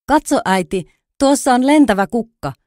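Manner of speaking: a fawn thrilled by a flying flower; excited exclamation. A narrator's reading. Pampi calls out excitedly to his mother. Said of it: "Pampi huudahti".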